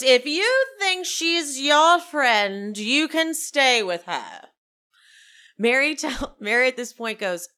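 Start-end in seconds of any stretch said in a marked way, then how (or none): 0.0-4.5 slow, loud haughty ultimatum; Meredith. Retold or imitated. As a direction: stretch each word with grand diva disdain. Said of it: "And Meredith goes".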